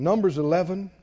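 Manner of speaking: ordinary delivery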